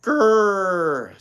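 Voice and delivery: loud growling engine rumble; bulldozer growl. Acted. Steam shovel trying to sound like a bulldozer. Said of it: "doing his best to sound like a bulldozer".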